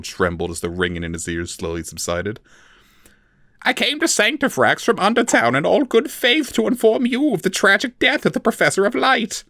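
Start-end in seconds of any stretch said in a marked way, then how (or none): 3.6-9.4 much higher timid elf voice; small frightened creature pleading; Forficule voice. A narrator's reading. The captive elf lies to his torturer, high and timid.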